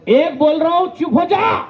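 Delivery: angry warning, rising in pitch; hammed-up military command; hot-tempered colonel. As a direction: order silence like an irate officer. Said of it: "colonel साहब इतने गुस्से वाले रहते हैं".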